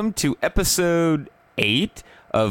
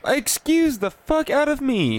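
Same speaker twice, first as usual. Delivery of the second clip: mock offended; 12 semitones higher than usual. Mock offense at being scolded.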